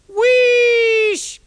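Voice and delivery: very high, very loud steam blast; great wheesh. A narrator's reading. James so excited he lets out steam, huge high wheesh.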